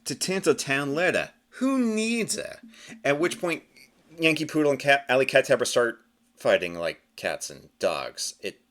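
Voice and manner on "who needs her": dismissive quote. Acted. Sneering quote from the comic.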